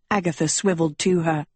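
ordinary delivery

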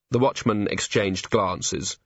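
ordinary delivery, nothing marked

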